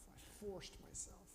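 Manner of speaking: whispered. Shown as a demonstration. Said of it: "repeats it whispered".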